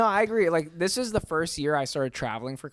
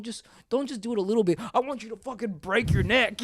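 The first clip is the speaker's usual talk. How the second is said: mock shout; 10 semitones higher than usual. Real, not acted. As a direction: belt it out for laughs. Hammed-up demand, shouting.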